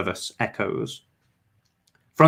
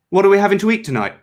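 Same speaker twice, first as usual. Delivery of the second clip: fast, high, louder spitting of the words; spat out; 10 semitones higher, 5 dB louder, much faster than usual. A narrator's reading. Cleanser spitting the words through his fingers, fast and loud. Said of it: "spat the cleanser from between his fingers".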